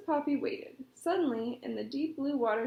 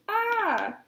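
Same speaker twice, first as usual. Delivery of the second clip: startled cry; 8 semitones higher, 7 dB louder than usual. A narrator's reading. Falling into the pond.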